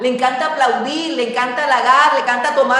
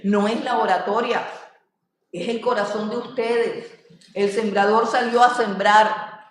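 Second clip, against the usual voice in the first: usual speaking voice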